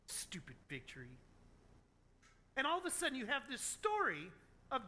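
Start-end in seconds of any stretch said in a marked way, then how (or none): 0.0-1.2 low, soft sulky grumble; mock pout, dropping low and quiet. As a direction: mutter it like a sulking child. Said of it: "as he's sitting there pouting".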